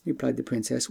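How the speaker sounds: plain reading voice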